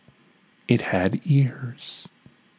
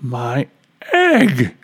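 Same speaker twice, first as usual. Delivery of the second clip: sudden excited shout; 2 semitones higher, 3 dB louder than usual. Acted. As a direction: burst out in joy. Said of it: "shouted Horton".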